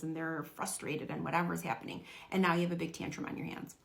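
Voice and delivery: ordinary talk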